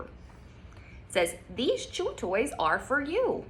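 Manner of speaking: bright storybook reading, slow on the details; reading to children. Acted. Teacher reading a picture book to kids, lively storytime voice. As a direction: read it aloud like storytime.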